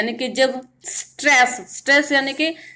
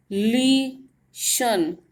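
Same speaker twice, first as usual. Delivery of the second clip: ली शन with ली held long, then a pause before शन; lingering first syllable; 3 dB quieter, much slower than usual. Shown as a demonstration. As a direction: dwell on ली in ली शन, very slowly. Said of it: "ली पे थोड़ा हमने ज़्यादा देर तक ठहराव किया है". (ली शन में ली पर देर तक ठहराव)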